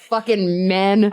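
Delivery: ordinary talk, nothing marked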